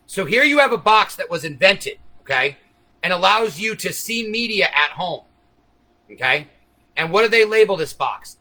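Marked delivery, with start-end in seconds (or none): none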